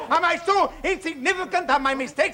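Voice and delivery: high, loud, pained shouting; anguished outburst. Acted. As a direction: explode in wounded protest. Father's outburst at the friend.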